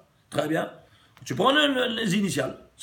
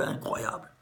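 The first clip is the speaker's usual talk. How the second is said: awed whisper; 9 dB quieter than usual. Real, not acted. Marvelling aside after the miracle.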